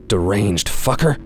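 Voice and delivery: ordinary delivery, nothing marked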